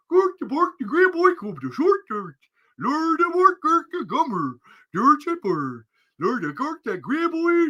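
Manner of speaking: high, gibberish sing-song chef; Swedish Chef. Retold or imitated. Imitating the Swedish Chef, high gibberish. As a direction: babble like the Muppet chef. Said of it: "Swedish Chef".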